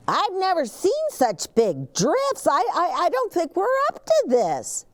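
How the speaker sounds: high, anxious little truck voice; Gus voice. A narrator's reading. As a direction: squeak it out nervously as little Gus. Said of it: "says Gus".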